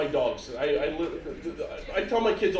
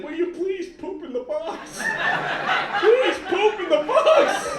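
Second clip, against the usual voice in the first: rising from high to much higher and louder, slow; desperate begging caller; 10 semitones higher, 3 dB louder, slower than usual. Acted. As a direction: beg more and more shrilly. Company begging, escalating.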